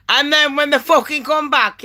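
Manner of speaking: normal talking voice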